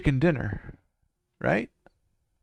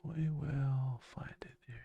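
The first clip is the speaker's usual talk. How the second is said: whispering; 11 dB quieter than usual. Real, not acted. Muttering to himself while searching.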